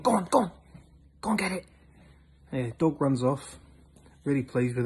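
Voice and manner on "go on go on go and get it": higher-pitched, eager command; urging a dog. Acted. Hunter sending his dog after the duck, urging. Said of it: "he says to his dog".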